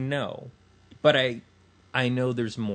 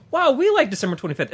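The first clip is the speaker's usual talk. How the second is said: higher, louder, gushing mock enthusiasm; mock eager reply; 10 semitones higher, 7 dB louder than usual. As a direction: play the overeager copycat. Acting out the church's eager reply.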